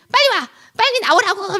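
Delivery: high, loud hurry-up yell; acted impatient holler; impatient shout. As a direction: yell as if calling someone out from outside. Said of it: "막 이렇게 소리쳤어요".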